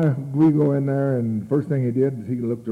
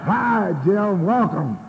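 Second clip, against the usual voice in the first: high, loud, drawn-out greeting; mock an over-eager welcome; gushing welcome; 8 semitones higher, 5 dB louder, much slower than usual. Acts out gushing meeting welcome.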